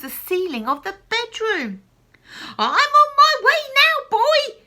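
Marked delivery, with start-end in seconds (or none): 2.6-4.6 very high, loud crone call; gleeful old woman hollering. Acted. Grandma calling down to George, loud. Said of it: "she called down to George".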